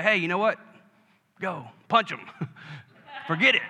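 mock hothead egging you on, laughing; mild impression of a young buck; brash young guy. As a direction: lightly play a reckless youngster. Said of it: "The young bucks, they say".

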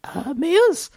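put-on high, squeaky little kid voice; a small child explaining seriously; preschooler. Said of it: "you have to use your little kid voice".

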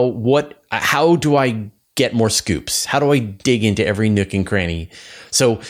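usual speaking voice

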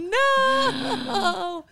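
long, high, laughing "No"; mock wail. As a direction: wail it in exaggerated dismay, half laughing. Retold reaction to sad news.

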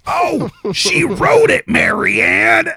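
put-on high, loud old woman blurting out; loud old lady gasp. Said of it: "Like, out loud".

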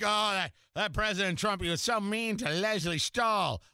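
lightly voiced prissy scandalized viewer; mock whiny critic. Host mocking viewers upset for Leslie Stahl, mock whiny voice. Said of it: "I don't know why they talk like that".

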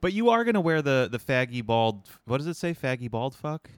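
high-pitched incredulous tease; teasing, high. Real, not acted. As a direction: tease with rising disbelief. Teasing friend about hat.

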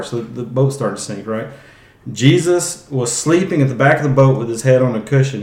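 ordinary delivery

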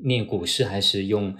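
ordinary talk, nothing marked

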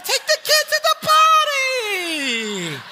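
high, shouted mock crowd voices; shrill far-off shouting of onlookers; scolding bystanders. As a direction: yell like strangers judging a parent.

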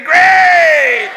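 high, loud call of Grace; leading a shout. Shown as a demonstration. Preacher leading the congregation in a shouted Grace. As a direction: call it out for the church to echo. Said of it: "somebody say".

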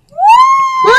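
high, loud excited woo; excited shriek. Real, not acted. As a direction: screech it out with joy. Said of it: "The sound of screeching".

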